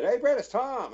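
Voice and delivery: high-pitched phone greeting, imitated; lightly acted, high caller's voice; Tom. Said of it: "I got a phone call from Tom who said".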